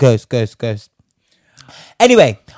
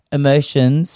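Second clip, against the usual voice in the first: usual speaking voice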